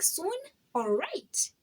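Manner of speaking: sudden high "Soon?" then a let-down "All right"; mock surprise. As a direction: pretend to be caught off guard by the word soon. Retold reply to God's promise.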